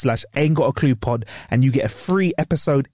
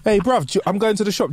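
ordinary talk, nothing marked

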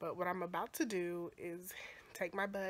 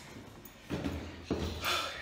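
a breathy, worn-out exhale on the stairs; tired sigh mid-climb; winded sigh. Real, not acted. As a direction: blow out the air like you're spent. Carrying bags up stairs.

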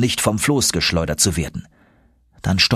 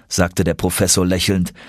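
ordinary delivery, nothing marked

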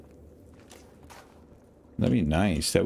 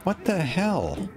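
high, slow disbelieving; incredulous; 12 semitones higher, slower than usual. Real, not acted. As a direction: stretch it out in disbelief. Suddenly can't see in game.

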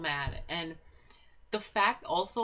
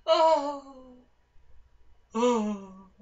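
high, loud wordless cry, falling away; re-enacted high wail of "oh"; 6 semitones higher, 10 dB louder than usual. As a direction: let out two drawn-out loud cries. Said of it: "And I'm just like".

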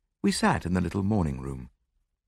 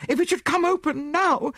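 high, panicky woman's voice; terrified gasp; 4 dB louder than usual. A narrator's reading. Frightened widow dreads the locked door opening, terrified. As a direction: voice shoots up in dread. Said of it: "she gasped".

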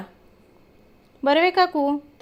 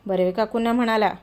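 ordinary delivery

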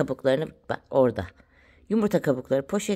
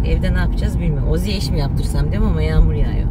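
ordinary delivery

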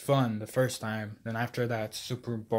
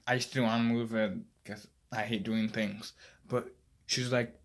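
normal talking voice

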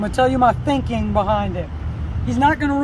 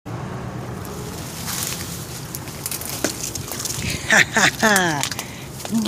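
breathy laugh; 5 semitones lower, 5 dB quieter than usual. Real, not acted. Laughing at the iguana.

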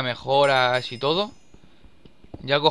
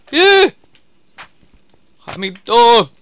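high, loud startled cry; startled yelp; 9 semitones higher, 13 dB louder, much slower than usual. Real, not acted. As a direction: yelp in sudden alarm. Sudden scare in game.

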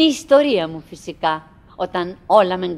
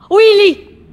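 high, very loud scream of his name; terrified scream; 10 semitones higher, 11 dB louder than usual. Acted. Wife screams for her husband as a mouse appears, terrified shriek.

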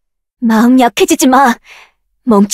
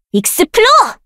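much higher, ringing; high spell call; 9 semitones higher than usual. Acted. Caster calling the spell high.

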